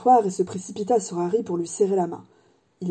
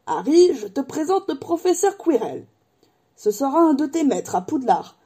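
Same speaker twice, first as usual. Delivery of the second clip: raised, animated character voice; hearty giant Hagrid, introducing; 6 semitones higher than usual. Acted. Hagrid presenting the professor to Harry, animated voice. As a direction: introduce a teacher with gusto.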